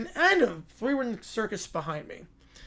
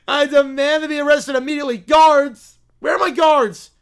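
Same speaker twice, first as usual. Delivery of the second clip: high, loud royal tantrum; imperious Little Princess; 5 semitones higher, 9 dB louder than usual. Acted. Princess demanding arrests, outraged shouting. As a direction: call out imperiously for the guards.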